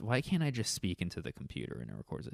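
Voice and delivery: usual speaking voice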